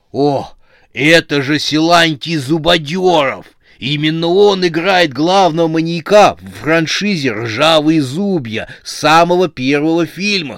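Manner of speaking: loud, high, gushing fan; starstruck excitement. A narrator's reading. Fan spots his idol at a café, starstruck. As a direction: blurt it out, stretching the film title.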